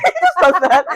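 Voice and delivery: high, loud words through laughter; helpless laughter. Real, not acted. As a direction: squeeze it out while cracking up. Cracking up at picture.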